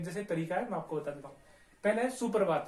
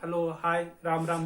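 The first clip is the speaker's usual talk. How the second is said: ordinary delivery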